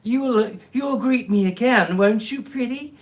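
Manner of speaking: put-on leer at him like a hideous old crone; horrible grinning crone. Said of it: "she said with a horrible grin".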